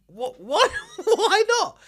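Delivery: what what, building in laughing outrage; incredulous laughing. Retold or imitated. Retelling the fufu refusal to the friend, laughing disbelief. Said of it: "I was like".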